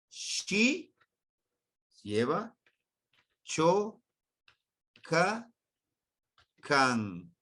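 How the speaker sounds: "Shi", "cho", "ka", "kan" split with pauses; syllable by syllable. Shown as a demonstration. Teacher splits the Nahuatl word for students, slowly. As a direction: break the word apart slowly. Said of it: "vamos a dividirlo".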